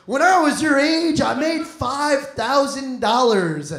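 put-on an old-timer preaching about the past; lightly voiced grumpy old man; old man lecture. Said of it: "I hate that whole".

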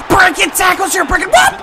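frantic high cheering; excited urging. Real, not acted. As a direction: yell the runner on in a high voice. Urging runner, getting excited.

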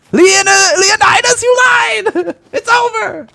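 very high, loud wail of betrayal; panicked screaming. Real, not acted. Betrayed and beaten in game.

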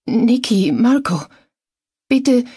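high, loud frightened plea; terrified woman giving her name; whimpering. A narrator's reading. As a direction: whimper it in fear, pitched well up. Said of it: "wimmerte jedoch".

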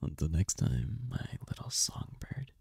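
soft, intimate whisper; whispered farewell. Acted. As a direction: whisper goodbye like a secret. Farewell to the songbird.

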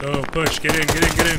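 loud urgent push call; urging shout. Real, not acted. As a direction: yell at the squad to move.

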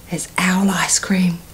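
spooky storybook whisper. Acted. Mouse whispering to owl to scare him. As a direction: lean in and whisper the secret.

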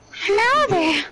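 high creepy greeting; creepy voice impression. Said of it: "How good are you at making voice impressions?".

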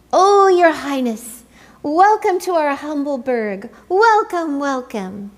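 bow and scrape eagerly; gushing welcome. A narrator's reading. Penguins greeting their emperor, loud fawning welcome.

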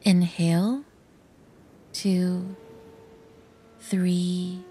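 slow, soft counting with long even pauses; guided breathing demo, one quiet word at a time; paced breathing count. Shown as a demonstration. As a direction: count out the breaths softly, unhurried. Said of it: "The breathing process goes like this".

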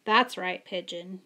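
ordinary delivery

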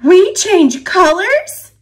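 high, loud startled question; surprised. A narrator's reading. Leaf learns it will change.

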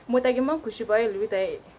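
ordinary delivery

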